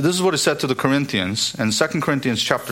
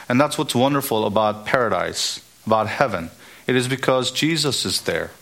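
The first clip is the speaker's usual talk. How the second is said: ordinary delivery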